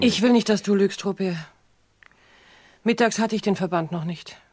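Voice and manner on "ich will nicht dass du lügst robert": raised, pleading voice, louder; a sudden loud plea; pleading outburst. Acted. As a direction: burst out begging him not to lie. Anguished plea to Robert.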